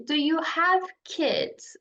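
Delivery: put-on "Do you have kids?" said slowly, word by word; slow teaching demo. Shown as a demonstration. Teacher drills the question slowly for learners. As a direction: model the question slowly for the class.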